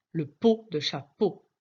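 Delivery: ordinary delivery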